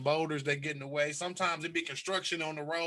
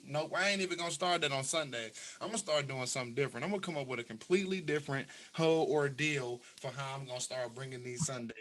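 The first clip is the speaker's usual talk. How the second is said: ordinary delivery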